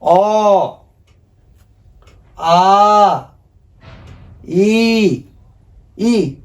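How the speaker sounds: each vowel अ, आ, इ, ई said alone and held, with a pause after each; drawn-out vowel drill. Shown as a demonstration. Teacher leading the class through Bangla vowels, slow and drawn out. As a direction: call out अ, आ, इ, ई one by one for the class to repeat. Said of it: "मेरे साथ आप लोग भी बोलिए".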